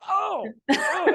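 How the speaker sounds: high, loud comic cries of surprise; hammed-up startled relatives; startled by glare. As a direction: act out being suddenly blinded. Relatives blinded by camera light, acted.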